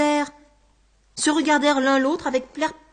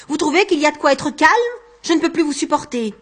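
louder, higher, exasperated outburst; exasperated anger; 4 semitones higher, 7 dB louder than usual. Acted. Man told to calm down by his tormentor, exasperated. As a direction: snap back, at the end of your patience.

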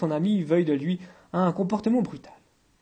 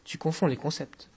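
a bit embarrassed; 6 dB quieter than usual. A narrator's reading. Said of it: "un peu embarrassé".

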